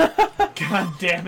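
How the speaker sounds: slow, laughing God damn it; laughing curse. Real, not acted. Dies again, laughing.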